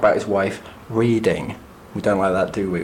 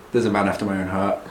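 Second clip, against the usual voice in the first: ordinary talk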